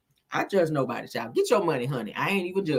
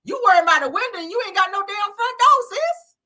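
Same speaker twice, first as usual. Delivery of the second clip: incredulous; 9 semitones higher than usual. Real, not acted. Incredulous reaction to clip.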